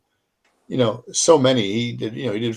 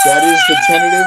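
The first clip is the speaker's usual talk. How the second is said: much higher, louder, bursting with excitement; can barely contain the news; excited; 7 dB louder than usual. Real, not acted. Host announcing the guest date, thrilled and loud.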